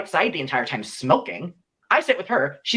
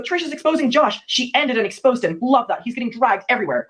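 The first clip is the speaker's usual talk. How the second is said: gleeful gossip; 8 semitones higher than usual. Retold or imitated. Gleeful texts retold about Josh.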